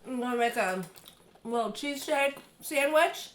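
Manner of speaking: usual speaking voice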